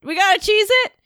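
"We gotta cheese it?" pitched high, rising at the end; questioning echo. Acted. Player queries the party's escape plan, "cheese it" rising as a question.

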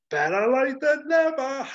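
high, loud hammed-up Brian Blessed bellow; big theatrical battle cry; booming hero shout. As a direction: bellow it like a triumphant warrior king. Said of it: "and he says".

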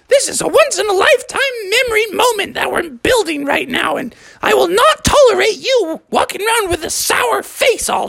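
high falsetto scolding mother; Mother Frog. Acted. Mother Frog telling off Father's attitude, high scolding falsetto. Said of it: "And Mother Frog said".

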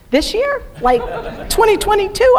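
high-pitched, incredulous questioning; mock disbelief. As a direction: jump up high as if caught off guard. Retold shock at the proposed date.

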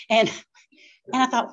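ordinary talk

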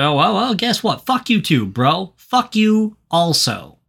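high-pitched mock outrage; mock indignation. Host voices lynch mob turning on Barney, mock indignant. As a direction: tell them off, hammed-up and high.

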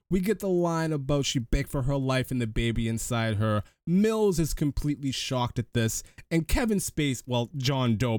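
normal talking voice

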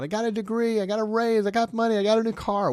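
high, loud mock bragging; mock boasting. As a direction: brag like someone showing off. Mocking worldly boasts.

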